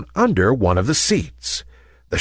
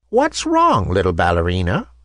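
gentle; 4 semitones lower than usual. A narrator's reading. Old lady to a weeping toy ballerina, gentle. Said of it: "asked a gentle voice".

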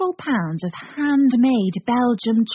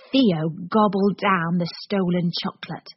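plain reading voice

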